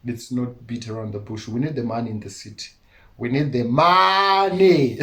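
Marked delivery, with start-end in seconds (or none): 3.2-5.0 louder and higher, drawn out slowly; a slow, raised rallying cry; emphatic slow declaration. Real, not acted. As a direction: lean hard on every word, slowly. Repeats the point, slow and loud.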